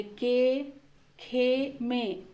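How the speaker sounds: के, खे, में with a long, steady e; e vowel. Shown as a demonstration. Teacher setting up a vowel contrast, steady e in के, खे, में. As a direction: hold one pure e in के, खे, में. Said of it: "उच्चारण थोड़ा बदल जाएगा".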